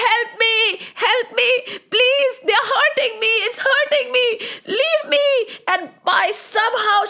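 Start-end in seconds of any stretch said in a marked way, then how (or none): 0.0-5.3 high, distressed cries for help; shouted plea. Acted. Cow being pulled away by soldiers, shouting for help. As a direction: cry out in pain, pitched high. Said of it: "And Nandini shouted".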